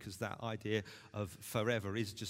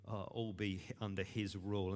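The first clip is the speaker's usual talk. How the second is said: normal talking voice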